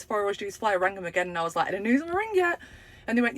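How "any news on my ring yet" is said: much higher, hopeful question; eager high ask. Retold or imitated. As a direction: chirp it up like a keen customer. Repeat eager call.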